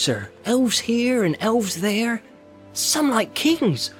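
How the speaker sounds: slow, high and wondering; awed. Acted. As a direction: linger over each word in awe. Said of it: "Sam marvels at the elves".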